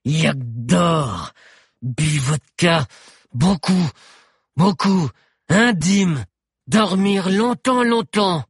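slow, loud, grating pidgin with drawn-out words. A narrator's reading. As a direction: grate out each fragment high and forceful. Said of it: "répondit Cro-Bleu d'une voix grinçante".

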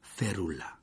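soft, whispery incantation; murmured spell. A narrator's reading. Lupin splints Ron's leg, murmuring the spell. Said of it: "et murmura".

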